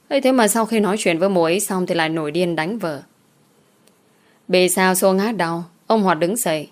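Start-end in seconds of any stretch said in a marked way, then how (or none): none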